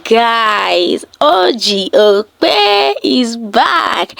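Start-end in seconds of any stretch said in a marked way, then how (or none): 1.2-4.1 slow, drawn-out announcer call; hype announcer. Acted. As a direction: stretch it out like a big reveal. Hyping up the returning presenter.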